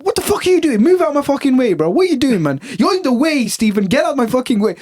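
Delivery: high, harsh imitated yelling at a coworker. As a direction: bark it like an impatient coworker.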